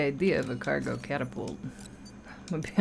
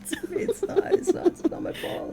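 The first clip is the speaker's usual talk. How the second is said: laughing. Real, not acted. Mock self-pity, laughing.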